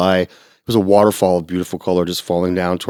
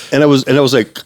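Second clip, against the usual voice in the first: ordinary talk, nothing marked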